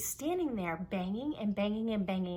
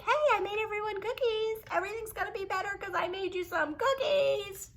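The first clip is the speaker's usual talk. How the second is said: much higher, sing-song mock of a cheery do-gooder; mocking Miss Happy Happy; 11 semitones higher than usual. Child mocking mom's cookie delivery, high mocking sing-song. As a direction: lampoon her as little Miss Happy Happy. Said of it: "little Miss Happy Happy".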